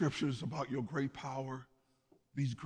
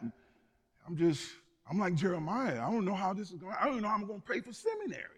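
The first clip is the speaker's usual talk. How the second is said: higher-pitched fretting, climbing as the worries pile up; imitated struggling churchgoer; 7 semitones higher, faster than usual. Churchgoer telling pastor about struggling with the calling, imitated fretting. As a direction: voice a worried believer, pitch rising and words tumbling. Voices overwhelmed believer doubting calling.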